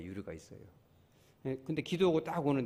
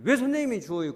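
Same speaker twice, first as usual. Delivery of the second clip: much higher, louder indignant why; mock scolding; 9 semitones higher, 8 dB louder than usual. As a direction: imitate a woman telling off the doctor. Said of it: "혼을 내고 그래".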